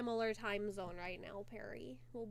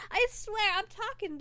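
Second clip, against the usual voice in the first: insisting loudly; 10 semitones higher, 9 dB louder than usual. Real, not acted. Insisting about her voice.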